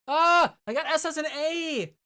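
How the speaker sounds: high, loud cry of dismay; gutted shriek; dismayed shout. Real, not acted. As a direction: yell at the bad grade.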